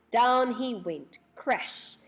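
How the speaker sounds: slow, weighty build then crash; dramatic slow fall. A narrator's reading. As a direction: land it like a fall. Gnome tripped and falls.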